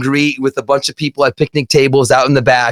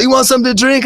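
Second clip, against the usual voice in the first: a higher, easygoing host voice; friendly offer; 10 semitones higher, much faster than usual. Retold or imitated. Dime offering the teen a drink, friendly and high. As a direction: offer it like a laid-back host.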